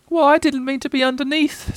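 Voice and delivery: high, small, plaintive voice; sad little Piglet. A narrator's reading. Piglet answering Pooh's apology, sad and squeaky. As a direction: plead it sadly in a high voice. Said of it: "said Piglet sadly".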